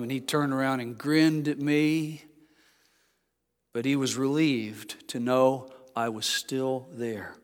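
ordinary talk

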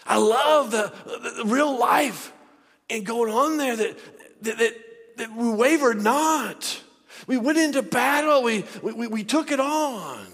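loud, high, halting with fervour; full-force preaching. Real, not acted. Preacher declaring that we went into battle, full-force preaching. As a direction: belt it out, stretching the key words.

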